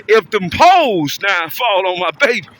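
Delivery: usual speaking voice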